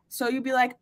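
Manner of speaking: ordinary talk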